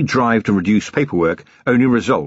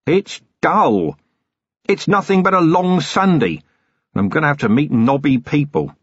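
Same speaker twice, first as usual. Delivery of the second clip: higher-pitched, disgruntled rant; exasperated grumbling; 7 semitones higher than usual. Acted. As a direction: rail against a dreaded trip. Acted rant against the countryside.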